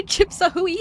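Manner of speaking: high laughing repeat. Shown as a demonstration.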